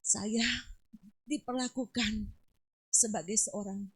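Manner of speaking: halting retold words of someone crying; tearful gratitude. Dying patient thanking visitor, imitated tearful halting gratitude. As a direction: break it up with long pauses, as if moved. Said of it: "nangis dia".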